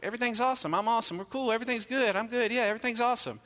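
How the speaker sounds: high, mock-cocky kid singing his own praises; smug kid voice. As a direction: puff up like a bragging child. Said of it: "that's like".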